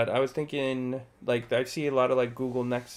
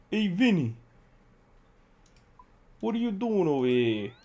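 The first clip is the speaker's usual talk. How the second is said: higher-pitched New Jersey Italian-American mobster call; Sopranos mobster accent; 6 semitones higher, 4 dB louder than usual. Acted. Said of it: "Their accents".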